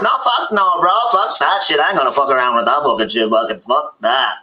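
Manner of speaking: high, tough refusal; girl refusing. Retold or imitated. Girl refusing the pickle monster, high defiant. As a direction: brush the monster off hard. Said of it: "And she goes".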